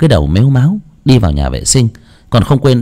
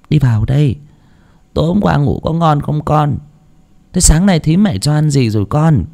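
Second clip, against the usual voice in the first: put-on fussing old mother; 6 semitones higher than usual. A narrator's reading. Grandmother greeting grandson.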